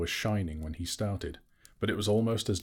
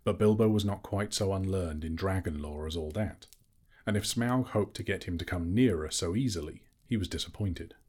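ordinary delivery

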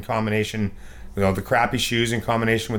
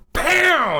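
very high sharp pow for the snapping line; a high imitated crack; pow snap; 3 dB louder than usual.

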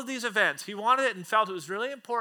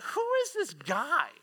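slow, higher-pitched mock bafflement; puzzled thought; 5 semitones higher, slower than usual. Disciples privately wondering about the man on shore, puzzled. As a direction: draw it out, doubting the stranger. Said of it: "thinking to themselves".

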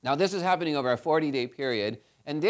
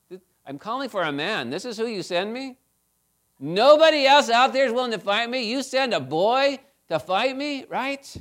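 mocking giant's taunt; 7 semitones higher, 5 dB louder than usual. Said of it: "he's probably just laughing at David".

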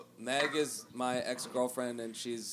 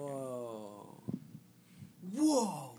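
amazed whoa. Real, not acted. Amazed reaction to the video.

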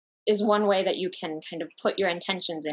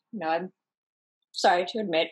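usual speaking voice

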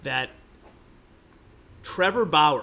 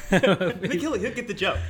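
laughing; 4 semitones higher, 4 dB louder than usual. Real, not acted. Laughing at his own joke.

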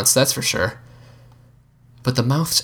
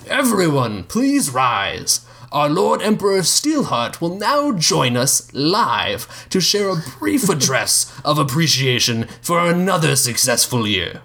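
slow, higher, ceremonial address to the room; formal announcement; 7 semitones higher, slower than usual. Acted. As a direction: announce the emperor grandly.

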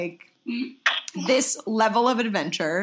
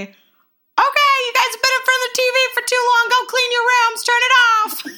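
much higher, louder, fast call; mom calling from the other room; 7 dB louder than usual. Retold or imitated. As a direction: call out like a mom ordering kids off the TV. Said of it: "my mom say".

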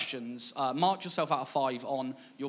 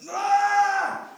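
high, loud frustrated scream; sudden shrieked outburst of frustration; worked-up scream; 8 dB louder than usual. Retold or imitated. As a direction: re-enact a teenager's exasperated scream. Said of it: "I'm so worked up".

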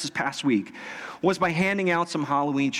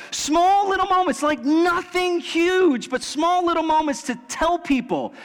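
high, driving sermon delivery; impassioned preaching; 9 semitones higher than usual. Real, not acted. Preacher urging church to bless others, impassioned. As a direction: preach it with rising intensity, leaning on key words.

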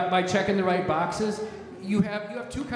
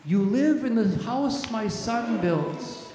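God rebuking the accuser; 5 semitones higher than usual. Acted. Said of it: "And he says to you".

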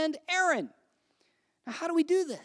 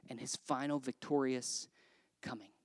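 whisper; 14 semitones lower, 10 dB quieter than usual. Real, not acted. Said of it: "whisper fade".

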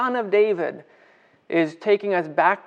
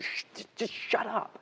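whispered shh, then a higher just shut up; hushing crowd; 6 semitones higher, 12 dB quieter than usual. Retold or imitated. Crowd telling the blind men to hush, whispered. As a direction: hush them, annoyed. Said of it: "everybody else just went".

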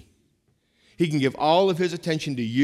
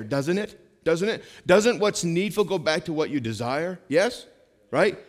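ordinary delivery

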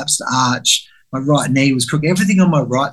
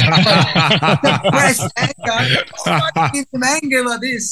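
mocking laugh; 9 semitones higher, slower than usual.

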